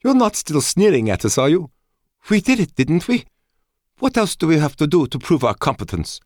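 high, loud, indignant woman's voice; irritated woman. A narrator's reading. Woman confronting him about sneering at Fleet, irritated. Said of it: "She was irritated".

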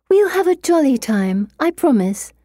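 high, sing-song humouring; jolly someone along like a little child; coaxing a child. A narrator's reading. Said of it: "treating me like a child".

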